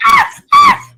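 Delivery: very high, loud shrieks of fright; startled shriek. Retold or imitated. Rat popping out of the trash can, high shrieks. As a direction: shriek like a rat just jumped out. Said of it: "You like".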